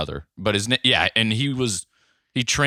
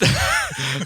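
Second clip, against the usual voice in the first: laugh. Real, not acted. Bursts out laughing mid-sentence.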